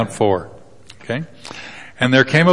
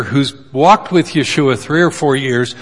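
normal talking voice

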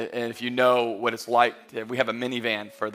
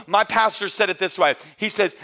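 ordinary talk

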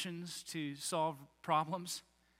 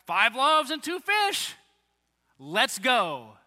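higher, louder, slow cheerful declaration; upbeat Andrew; 4 semitones higher, 7 dB louder, much slower than usual. Retold or imitated. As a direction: say it like the problem is solved.

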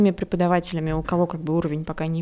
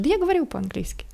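higher, breezy mock boast; cocky job applicant; 9 semitones higher than usual. Interviewee claiming fluent English, mock cocky boast. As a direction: toss it off like a self-assured candidate. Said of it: "такие".

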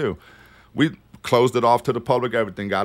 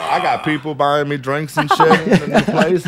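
laughing through the words; real laughter over slower words; 4 semitones higher than usual. Real, not acted. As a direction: chuckle it out as he admits it. Laughing while recalling being drunk.

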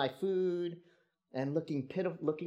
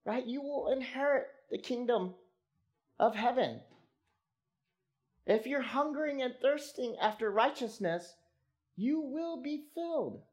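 high, raised voice of God promising; God speaking; 10 semitones higher than usual. Acted. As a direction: speak as God reassuring the faithful, lifted high. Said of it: "But God is saying".

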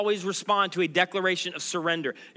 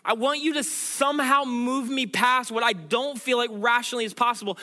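raised-pitch, urgent sermon appeal; impassioned preaching; 3 semitones higher than usual. Real, not acted. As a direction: preach it as a heartfelt declaration to God.

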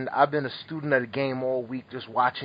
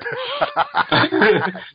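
loud, high burst of laughter; amused laughter; 13 semitones higher, 7 dB louder than usual. Real, not acted. Amused by son's pillow attacks.

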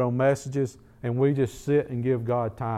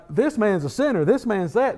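higher-pitched imitation of accusers; mock accusation; 6 semitones higher than usual. Accusers talking about the healed man, mock accusation. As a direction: rattle off the charges in a raised pitch.

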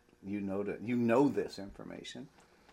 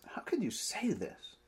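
whispered, weary disbelief; exasperated whisper; 7 semitones higher, 7 dB quieter than usual. Acted. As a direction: breathe the question in quiet exasperation. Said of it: "Jesus is a bit exasperated here".

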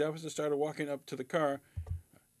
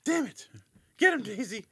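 higher frustrated shout to the dog; frustrated yell; 6 semitones higher, 4 dB louder than usual. Pretend. Homeowner yelling to dog.

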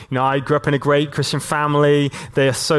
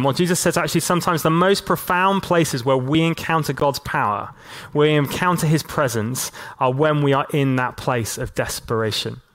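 usual speaking voice

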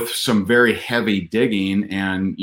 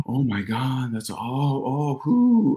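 breathless, higher and softer wife's delight; imitating excited wife; 4 semitones higher, 4 dB quieter, slower than usual. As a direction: mimic his wife gasping with excitement. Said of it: "She's all hot and bothered, and she's excited".

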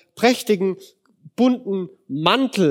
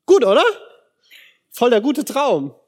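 loud, high ironic question; sarcastic; 5 semitones higher, 4 dB louder than usual. Real, not acted. Preacher mocks Josef's dream to the congregation, sarcastic. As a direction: throw it out with heavy sarcasm. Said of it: "ironic question".

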